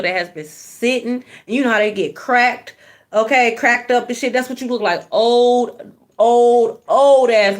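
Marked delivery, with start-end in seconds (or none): 5.1-7.6 building insult. Real, not acted. Reader roasting a bitter hater, building louder.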